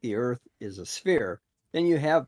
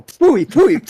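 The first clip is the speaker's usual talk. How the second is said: much higher, louder disgusted spitting; dog spitting it out; 13 semitones higher, 16 dB louder than usual. Pretend. Guest imagines the dog tasting Bev and spitting, loud high mock disgust. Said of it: "he'll go".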